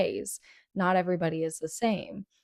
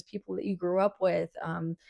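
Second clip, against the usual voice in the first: usual speaking voice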